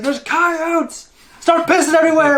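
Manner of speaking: very high, loud mock panic; retold panicked warning. Friend on a cooler warning of coyotes, mock panicked. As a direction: imitate a frantic cry of alarm.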